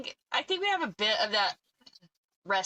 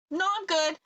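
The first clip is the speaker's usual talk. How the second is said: high, lightly acted brush-off; retold polite refusal; 9 semitones higher than usual. Turning down a golf cart ride, high polite refusal. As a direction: wave off the offer in a higher voice.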